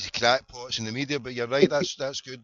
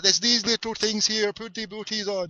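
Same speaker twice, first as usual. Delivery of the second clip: imitated child explaining; 8 semitones higher than usual. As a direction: mimic a child showing what booties are. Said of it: "It's like".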